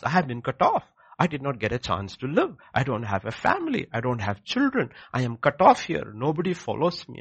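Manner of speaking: loud, high lament of the slain brother; voice of Abel's blood. Acted. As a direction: cry out as the murdered man's blood.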